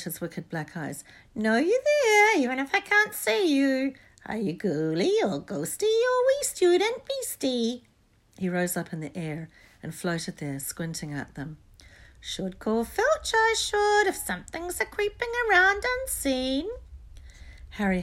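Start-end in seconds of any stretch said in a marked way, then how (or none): 1.3-7.9 very high, loud, slow sing-song creature; wicked taunting poltergeist. A narrator's reading. Peeves teasing unseen students on the stairs, wicked taunting. 12.2-16.7 very high, loud, slow sing-song creature; wicked taunting poltergeist. A narrator's reading. Peeves teasing unseen students on the stairs, wicked taunting.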